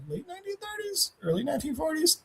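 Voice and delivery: very soft, high murmur; second-guess yourself in a tiny high voice; hushed unsure aside. Real, not acted. Unsure aside about the date.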